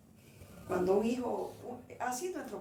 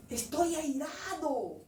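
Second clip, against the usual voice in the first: drawn-out, higher imitation of God's anger; imitating God's strong voice; 6 semitones higher, 4 dB louder, much slower than usual. God tells a praying woman of wrath, imitated strong voice. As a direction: boom out God's anger, stretching the words. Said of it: "Me habló fuerte, una voz".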